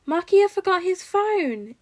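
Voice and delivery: put-on high, loud and slow little boy; smiling toddler. A narrator's reading. Three-year-old explaining to his father, high and smiling. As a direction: inform him brightly like a small child. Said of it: "Izuku informs him, still smiling".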